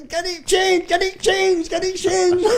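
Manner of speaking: very high, sing-song mock voice; mocking sergeant major. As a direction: taunt the recruit in a shrill parody.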